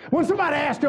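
ordinary talk

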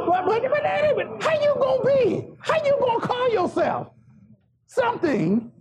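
high, insistent pulpit questioning; full-force preaching. Acted. Preacher challenging the congregation on who they are, full-force preaching.

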